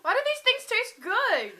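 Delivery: high, loud amazed outburst; surprised. Real, not acted. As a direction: blurt it out in disbelief. Surprised tasting weird food.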